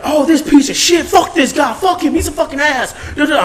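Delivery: high hammed-up cursing of a loyal customer; imitating angry regular. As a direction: voice the regular blowing up at the thief. Regular voiced cussing out thief.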